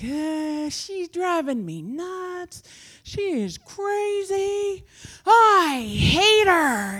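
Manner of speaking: high, slow whiny wail building to a loud cry; hammed-up exasperated complaint, words stretched out; mock venting. Woman vents to the Lord about her houseguest, mock wailing.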